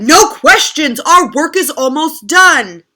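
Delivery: loud, high cutting off; bossy. Acted. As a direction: shut them down loudly. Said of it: "Moose said".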